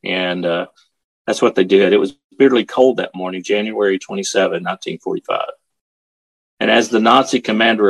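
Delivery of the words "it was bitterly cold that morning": ordinary delivery, nothing marked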